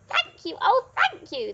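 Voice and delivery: high, gushing witch's thanks; grateful cry. A narrator's reading. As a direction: gush with heartfelt relief as the witch. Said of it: "the grateful witch cried".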